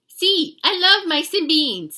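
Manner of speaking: high, loud enthusiastic reply; eager little hero. A narrator's reading. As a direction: answer with gusto as a tiny cartoon dog. Hero answers eagerly in character.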